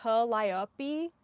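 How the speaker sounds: Calliope as kuh, lie, OH-pee with the accent unsure; sounding out. Real, not acted. Knitter hunting for the accent, Calliope as separated kuh-lie-oh-pee. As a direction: piece Calliope together syllable by syllable, questioning. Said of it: "Where's the accent?".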